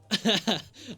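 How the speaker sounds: quick amused laugh; brief real laugh; short laugh. Real, not acted. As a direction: laugh at the scene. Laughs after the quote.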